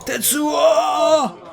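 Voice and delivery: high shout of a name; high shouted movie quote. Retold or imitated. Answering Akira movie quote.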